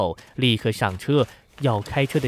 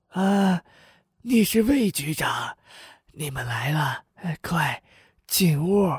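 put-on high-pitched old man, stirred up; agitated old man; 6 semitones higher than usual. A narrator's reading. Old man recognizing the police chief who visited before, agitated. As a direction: welcome them in an excited, much higher old man's voice. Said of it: "老汉激动地说".

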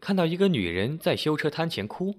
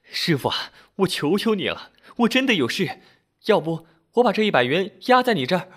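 high, urgent begging; pleading; 7 semitones higher, 4 dB louder than usual. A narrator's reading. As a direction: beg him in a raised, pitched-up voice. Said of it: "那女人哀求道".

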